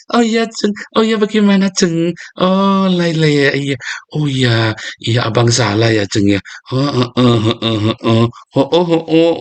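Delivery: put-on high, sweet doting boyfriend voice; courting boyfriend. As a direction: play the smitten suitor agreeing to everything. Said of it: "Dulu lagi pacaran".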